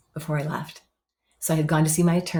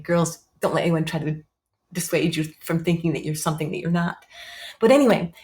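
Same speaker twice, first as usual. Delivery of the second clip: ordinary talk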